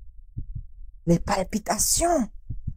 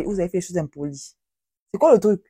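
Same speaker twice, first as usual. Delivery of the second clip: ordinary talk, nothing marked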